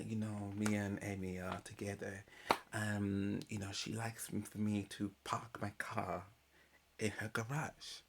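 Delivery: hushed, secretive retelling; confiding hush. Retold or imitated. Retelling Kieran's confession to Luke in a whisper. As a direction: drop to a near whisper like sharing a secret. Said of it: "He goes, "Between me and you".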